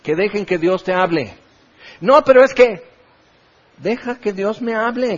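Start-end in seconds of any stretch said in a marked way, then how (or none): 2.0-5.2 lightly voiced whiny objector; a hint of a complaining congregant brushing off advice; mock defensive churchgoer. Pastor mimicking a defensive listener, mild mock whine.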